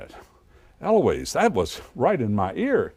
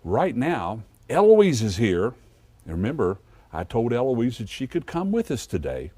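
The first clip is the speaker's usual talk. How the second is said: put-on kids show host; 5 dB louder than usual.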